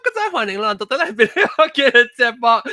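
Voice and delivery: high, loud radio-host opener; host intro. Real, not acted. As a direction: open the show like a DJ. Host opens the live show.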